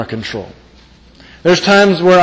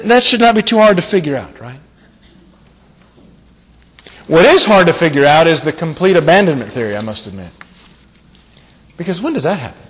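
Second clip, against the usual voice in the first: normal talking voice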